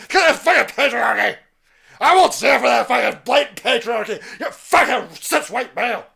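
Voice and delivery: loud, high shrill-sounding parody yell; mock activist shout. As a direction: rant like an outraged activist.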